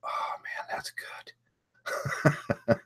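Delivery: breathy hushed "oh man that's good"; whispered delight. Retold or imitated. Tasting his perfectly roasted coffee, whispered awe. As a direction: hush it like savoring a perfect sip. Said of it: "and I was like".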